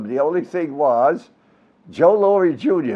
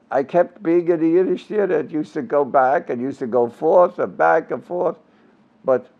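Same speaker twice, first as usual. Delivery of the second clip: ordinary talk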